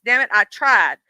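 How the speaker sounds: ordinary delivery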